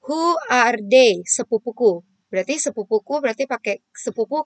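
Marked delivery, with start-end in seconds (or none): 0.1-1.2 very slow, louder and clear; a teacher modelling a question for the class; slow model reading. Shown as a demonstration. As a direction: pronounce it word by word. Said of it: "terus ke tengah kan".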